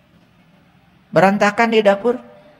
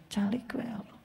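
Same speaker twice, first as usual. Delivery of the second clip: whispered "Calik we Allah"; whispered plea; 18 dB quieter than usual. Pretend. Mock worshipper whispering to God to stay silent. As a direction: whisper it as a secret request.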